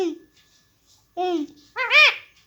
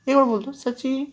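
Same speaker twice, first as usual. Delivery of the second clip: ordinary talk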